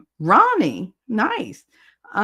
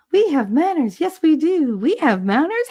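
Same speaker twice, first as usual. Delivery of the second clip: much higher, swooping mock-prim voice; playful put-on voice; 9 semitones higher, 4 dB louder than usual. Streamer playfully repeating herself to chat, high put-on voice. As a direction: parrot the line in a high, lilting character voice.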